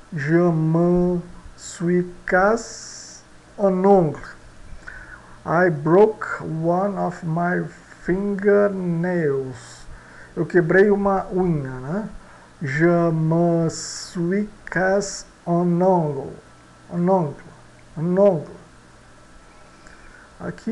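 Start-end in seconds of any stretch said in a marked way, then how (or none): 0.1-4.2 Je me suis cassé un ongle said very slowly, word by word; slow French example. Shown as a demonstration. Teacher reading the example sentence slowly to students. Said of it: "Vamos ao nosso exemplo aqui". 12.6-18.6 Je me suis cassé un ongle said very slowly, word by word; slow French example. Shown as a demonstration. Teacher reading the example sentence slowly to students. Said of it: "Vamos ao nosso exemplo aqui".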